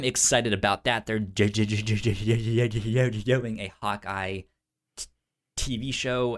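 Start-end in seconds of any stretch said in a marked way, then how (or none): none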